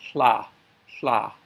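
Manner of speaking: "hla" as tla, T held then released to L; TL sound. Shown as a demonstration. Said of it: "hold the T and release to L".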